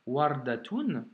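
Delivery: wardatoun said slowly, syllable by syllable; slow demo reading. Shown as a demonstration. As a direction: read wardatoun slowly and clearly. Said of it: "consonne, voyelle". (wardatoun lu lentement, syllabe par syllabe)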